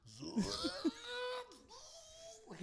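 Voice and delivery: high, drawn-out imitated energy noise; Dragon Ball Z power-up. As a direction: mimic an anime charge-up sound. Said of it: "fucking Dragon Ball Z".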